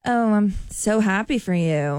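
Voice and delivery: slow, flat and insincere; fake, flat congratulations. Shown as a demonstration. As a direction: say it slow with no real joy behind it. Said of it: "But if I were to say".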